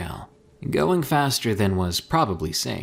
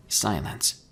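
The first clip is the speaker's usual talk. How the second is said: usual speaking voice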